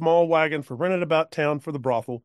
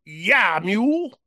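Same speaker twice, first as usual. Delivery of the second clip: put-on drunk cowpoke; 5 semitones higher than usual. Drunk cowpoke offering the woman a ride, high and loose. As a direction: slur it like a tipsy old man.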